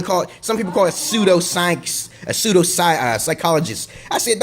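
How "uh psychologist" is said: ordinary talk, nothing marked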